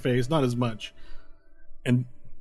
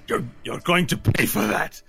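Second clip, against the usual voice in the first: higher put-on voice of a wronged brawler; angry enemy threat; 5 semitones higher than usual. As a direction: threaten like a humiliated thug. Angry enemy with poo on face.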